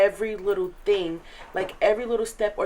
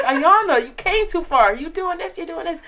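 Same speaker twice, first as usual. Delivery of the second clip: encouraging counselor; 9 semitones higher, 6 dB louder than usual. Retold or imitated. Counselor voiced encouraging her.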